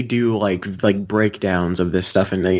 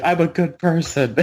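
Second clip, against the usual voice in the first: Jerry; 6 semitones higher than usual. Acted. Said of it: "and then Jerry's like".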